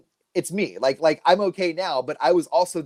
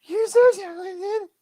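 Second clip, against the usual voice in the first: slow, gushing falsetto of a doting sister; Marty talking like she's twelve; 3 dB louder, much slower than usual. Acted. Sister praising brother's garage project, mock little-girl voice. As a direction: coo admiringly in a tiny, very high voice. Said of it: "She talks like she's twelve".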